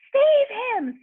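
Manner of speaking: high, loud panicked scream; mother's high panicked scream. A narrator's reading. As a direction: cry for help in panic. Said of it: "screamed Missus Gloop".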